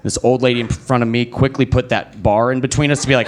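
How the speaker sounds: ordinary delivery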